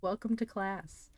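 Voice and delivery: normal talking voice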